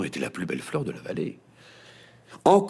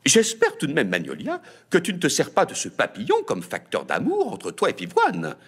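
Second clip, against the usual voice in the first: suspicious mother; 7 semitones higher, 3 dB louder than usual. A narrator's reading.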